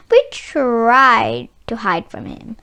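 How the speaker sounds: ordinary delivery, nothing marked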